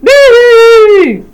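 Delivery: loud, high hammed-up boo-hoo; mock wailing sob. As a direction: overdo the crying on purpose. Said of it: "burst into floods of tears".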